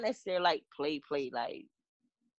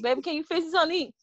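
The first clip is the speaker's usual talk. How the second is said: sweet pleading husband; 6 semitones higher, much faster than usual. Retold or imitated. Voicing husband asking sweetly.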